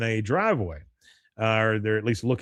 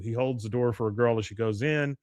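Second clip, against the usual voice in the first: ordinary talk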